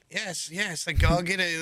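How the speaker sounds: lightly voiced creepy ghoul butler. Creepy French steward obeys the stewardess, ghoulish butler voice. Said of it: "French ghoul is just creepy as ever".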